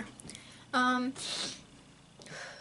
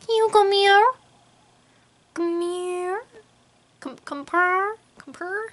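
high, loud coaxing call; baby talk to pet; 7 semitones higher, 9 dB louder, slower than usual. Acted. Owner calls her cat to come cuddle, high coaxing baby talk. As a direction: coax the cat to come purr.

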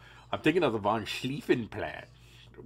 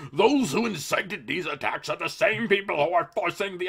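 much higher, louder cartoon politician; 6 semitones higher, 8 dB louder than usual. Host reads the EU president's quote in a silly voice. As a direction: lampoon him with a goofy pompous voice. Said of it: "make him sound silly".

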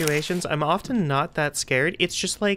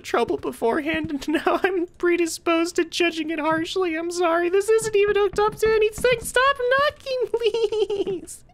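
high, squeaky voice cracking with laughter; can't stop giggling through the words; giggly pleading through laughter; laughing fit; 12 semitones higher than usual. Real, not acted. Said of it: "Compose myself".

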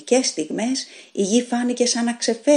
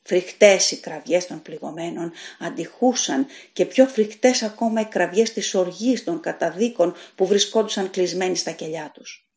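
ordinary delivery

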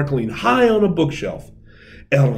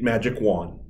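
ordinary delivery

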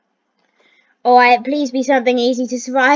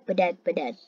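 resigned fade; 6 semitones lower, 11 dB quieter than usual. Real, not acted. Resigned, fading out.

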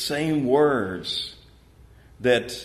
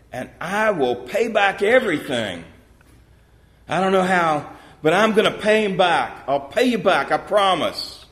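higher, louder pleading promise; desperate begging; 6 semitones higher, 5 dB louder than usual. A narrator's reading. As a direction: beg him loudly not to punish you. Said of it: "he begged".

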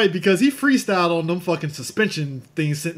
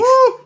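a high, loud whoop with a laugh; excited whoop; 11 dB louder than usual. Real, not acted. Cheering a friend's story with a loud laughing whoop.

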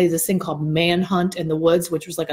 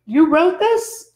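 slow, higher, louder imitation of a surprised kid; incredulous child; 9 semitones higher, 5 dB louder, much slower than usual. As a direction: imitate a little girl amazed at the discovery. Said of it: "and she goes".

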